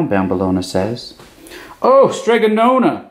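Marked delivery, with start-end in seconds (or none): 1.8-3.1 high, bright shouting with joy; gleeful high shout; excited shout. A narrator's reading. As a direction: burst in with the happy news. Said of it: "Big Anthony shouted".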